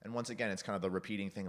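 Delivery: usual speaking voice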